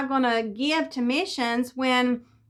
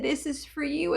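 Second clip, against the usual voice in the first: gentle offer; 3 semitones higher, 5 dB quieter than usual. Retold or imitated. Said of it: "Even the way that my neighbor said it".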